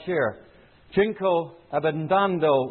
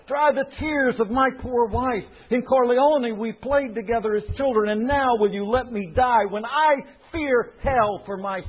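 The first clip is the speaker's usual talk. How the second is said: higher, pleading cry of a dying man; quavering desperate plea; 6 semitones higher than usual. Acted. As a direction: beg the Godfather for life in terror. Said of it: "The quavering voice grew louder".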